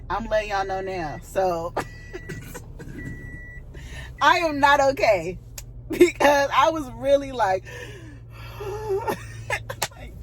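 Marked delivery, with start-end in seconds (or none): 1.8-4.2 squeaky airy laugh; high breathy giggle. Real, not acted. Laughing at herself. 7.7-9.8 whimpering sigh. Real, not acted. Embarrassed over crying.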